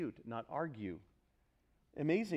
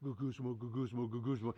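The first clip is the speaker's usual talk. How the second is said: low, softer onomatopoeic muttering of GUGUSMO; dissatisfied grumbling; 3 semitones lower, 4 dB quieter than usual. Shown as a demonstration. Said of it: "You hear it in a crowd that's dissatisfied".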